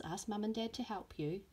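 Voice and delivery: usual speaking voice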